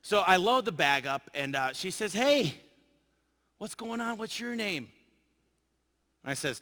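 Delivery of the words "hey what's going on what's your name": a hint of a chatty woman, pitched up; lightly raised voice for the attendant; lightly voiced flight attendant. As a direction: imitate her higher-pitched, friendly questions. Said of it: "this flight attendant is standing there".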